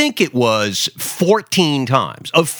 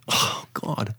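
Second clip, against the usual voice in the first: whispered thought; 9 dB quieter than usual. Retold or imitated. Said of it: "And I'm thinking".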